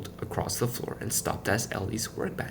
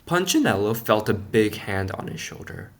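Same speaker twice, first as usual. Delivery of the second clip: usual speaking voice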